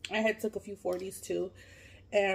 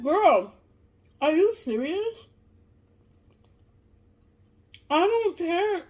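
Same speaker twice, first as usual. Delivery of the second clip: high, loud, exasperated disbelief; incredulous retort; 9 semitones higher, 7 dB louder, slower than usual. Retold or imitated. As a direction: throw it back at her, high and indignant. Acted retort to the girl's call.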